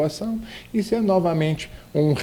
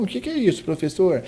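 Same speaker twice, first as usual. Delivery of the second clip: lightly voiced pupil, higher and a little softer; questioning student; 5 semitones higher, 3 dB quieter, faster than usual. Pupil asks the teacher what intonation is, lightly voiced query. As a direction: pipe up as if asking the teacher.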